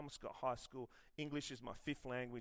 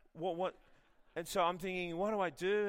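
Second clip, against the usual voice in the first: high, loud, flustered; a touch of mock panic, higher and louder; panicked; 6 semitones higher, 7 dB louder than usual. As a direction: act out the panic of being asked. Retelling his panic.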